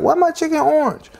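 imitating a surprised viewer, high pitched; mock confused. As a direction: squeak it out like a baffled home cook. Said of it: "So you be like".

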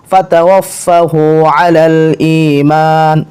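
very slow, drawn-out vowels; measured devotional chant with pauses; Arabic prayer recitation. Acted. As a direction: recite it phrase by phrase, long steady notes.

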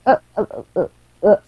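muffled by a headphone cord; mouth tangled in headphones. Real, not acted. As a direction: sounds with a cable caught in the mouth. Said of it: "got my mouth all tangled up with my headphones".

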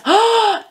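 sharp high gasp of awe; real gasp. Real, not acted. Vlogger moved by a film quote, sharp awed gasp. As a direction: gasp as if struck by the line.

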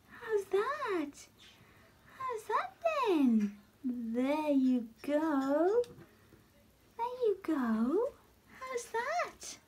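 gushing pet-voice, high and drawn out. Real, not acted. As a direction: coo at the spider like it's a newborn. Cooing at new pet spider.

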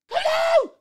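a sudden high-pitched, loud "Hello!"; yelling hello at the players; high call. Real, not acted. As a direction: call out at the screen in a sharp, high voice. Calling out at the screen.

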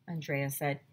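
usual speaking voice

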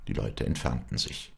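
usual speaking voice

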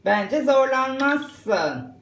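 normal talking voice

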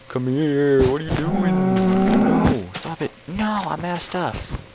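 high, stretched-out wail; whiny pleading. Pretend. Player out of stuff begging an animal, high wail. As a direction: beg it to stop, high and whiny.

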